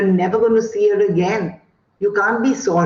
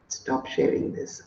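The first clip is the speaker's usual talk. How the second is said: whisper; 10 dB quieter than usual. Real, not acted. Muttered aside to self, screen share.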